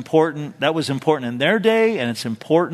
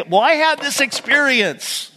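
high mock voice of a know-it-all; mocking the self-taught; 10 semitones higher than usual. Preacher mocking people who trust their experience, high and mocking. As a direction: sneer it out like a stubborn believer. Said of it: "They want to say".

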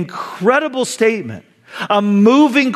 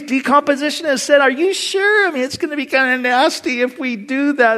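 worried Martha; 7 semitones higher than usual. Acted. Preacher voicing Martha doubting at the tomb, high and worried. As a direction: voice Martha's anxious doubt, pitched up.

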